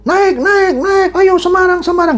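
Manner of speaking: high-pitched tout's call; bus tout. Acted. Tout calls passengers to the Semarang bus, calling out. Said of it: "sama kayak calo kan".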